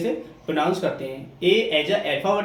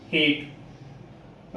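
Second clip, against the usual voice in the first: हेट breathed out, lower and softer; softly whispered hate; 4 semitones lower, 5 dB quieter, much slower than usual. Shown as a demonstration. Teacher contrasts हेट with हैट, whispered. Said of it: "इसको softly बोलेंगे".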